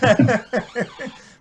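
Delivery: loud, high peal of laughter; real laugh. Real, not acted. Comedy fan recalling his only encounter, laughing loudly. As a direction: laugh hard at your own tale.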